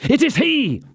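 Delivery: high, urgent cry of recognition; passionate exclamation. A narrator's reading. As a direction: blurt it out, beside yourself. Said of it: "exclaimed D'Artagnan, pale with passion".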